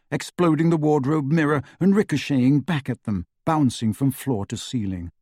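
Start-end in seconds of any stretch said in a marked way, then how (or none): none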